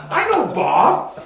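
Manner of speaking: high, loud and slow, like it finally clicked; sudden recognition. Retold or imitated. Telling a friend about the New Zealand bar, a high, drawn-out "I know Bob". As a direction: stretch out each word in delight. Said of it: "And I'm like".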